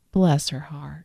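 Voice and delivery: whispered Southern "Bless her heart"; sister's Southern pity in a whisper; hushed whisper. Retold or imitated. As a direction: breathe it like gentle gossip. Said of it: "So they'll say".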